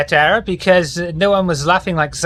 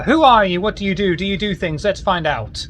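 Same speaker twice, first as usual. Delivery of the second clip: higher, bright host patter introducing the player; game show host intro; 3 semitones higher, faster than usual. Acted. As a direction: hype up each guest like a show host. GM's host intro for each player.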